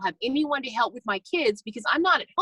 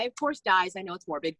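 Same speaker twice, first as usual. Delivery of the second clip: ordinary talk, nothing marked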